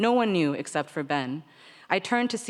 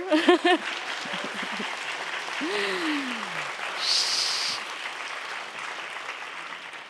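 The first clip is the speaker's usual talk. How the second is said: long airy laugh trailing off; breathy laugh; 10 semitones higher than usual. Real, not acted. Reader laughing at her own spoiler, a long airy laugh.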